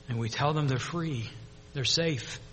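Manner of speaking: very soft, soothing; tender reassurance. Acted. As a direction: tell them softly that danger is over. Telling survivors they are safe.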